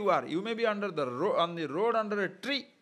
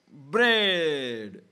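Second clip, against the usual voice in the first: put-on toddler demanding; 4 semitones lower, 6 dB louder than usual. Three-year-old demanding bread.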